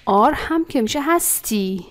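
ordinary talk, nothing marked

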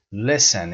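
"Listen" with a brief, lax first vowel; correct short i. Shown as a demonstration. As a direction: keep the i in "Listen" short, never ee. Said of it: "یعنی ای کوتاه".

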